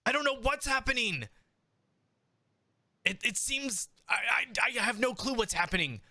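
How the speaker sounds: high, fast, pleading voice of a frightened showman; accused showman begging for help, high and frantic; panicked Chaz. Acted.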